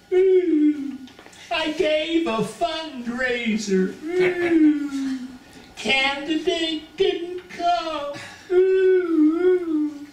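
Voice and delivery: hammed-up high-pitched wailing; hysterical crying. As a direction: wail it out slowly like a comically distraught sobber. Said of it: "began to cry hysterically".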